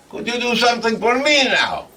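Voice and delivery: high, loud, quick request; eager asking. Acted. [name] echoes girlfriend's favour request.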